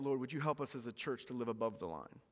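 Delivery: usual speaking voice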